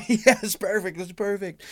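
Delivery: high, thrilled affirmation; delighted approval. Acted. Writer typing up colleagues' riffs, delighted. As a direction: gush approval while taking it all down.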